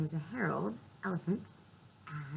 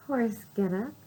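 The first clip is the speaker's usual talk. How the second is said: ordinary talk, nothing marked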